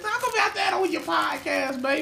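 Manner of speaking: high-pitched imitation of mama; mama's voice. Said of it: "my mama was talking about that".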